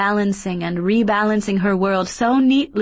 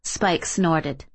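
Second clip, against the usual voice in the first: plain reading voice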